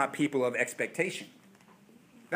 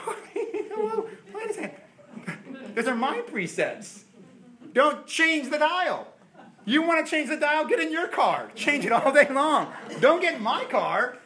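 mock outrage; 10 semitones higher, 3 dB louder than usual. Driver to a passenger touching his radio dial, laughing mock outrage. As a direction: play the possessive driver.

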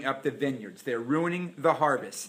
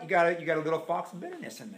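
ordinary delivery

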